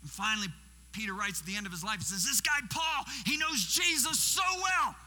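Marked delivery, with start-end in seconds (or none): 2.2-5.0 high, loud, drawn-out amazement; amazed exclamation. Acted. Peter writing about Paul's closeness to Jesus, loud amazed exclamation. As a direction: exclaim it in awe, building louder.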